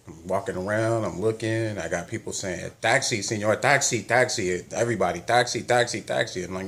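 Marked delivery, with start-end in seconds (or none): 2.8-6.4 loud, higher touting calls for a cab; taxi drivers calling. Retold or imitated. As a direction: call out to passing travellers like a pushy driver.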